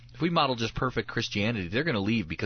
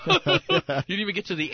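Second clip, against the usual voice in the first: laughing through the words; can't help laughing mid-joke; 11 semitones higher, 5 dB louder than usual. Real, not acted. Laughing at his own joke.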